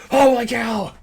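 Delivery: high, loud startled yelp; mock surprise. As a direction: yelp it like you just saw a miracle. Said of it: "I was like".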